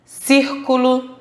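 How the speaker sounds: slow, careful whole-word reading of Círculo; read aloud. Shown as a demonstration. Teacher reading a word list aloud to pupils, slow read-aloud. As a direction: say Círculo as one word, stretched and clear. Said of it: "Leia as palavras a seguir em voz alta".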